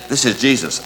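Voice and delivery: ordinary talk, nothing marked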